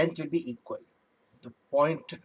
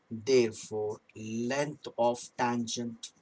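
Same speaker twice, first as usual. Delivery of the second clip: slow dictation; slower than usual. Shown as a demonstration. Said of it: "teacher writing the result slowly".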